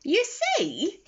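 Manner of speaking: put-on a kindly old friend explaining; wise cafe owner. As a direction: let him share a secret gently. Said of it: "said Mister Rossetti".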